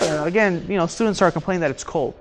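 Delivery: ordinary talk, nothing marked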